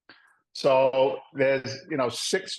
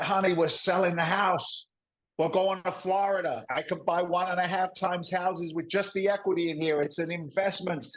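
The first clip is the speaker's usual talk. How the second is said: higher-pitched, acted enthusiasm; eager husband; 6 semitones higher than usual. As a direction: sell the big plan to your wife. Retold pitch to wife.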